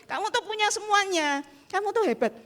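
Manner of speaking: lifted high, cheering her on; encouraging praise. Retold or imitated. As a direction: pitch up to build her confidence. Encouraging a discouraged friend.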